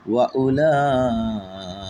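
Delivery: slow Quranic recitation, vowel held long; long drawn vowel. Shown as a demonstration. Teacher showing a long vowel to students, drawn out. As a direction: stretch the vowel four counts. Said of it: "চার আলিফ টানে পড়তে হয়".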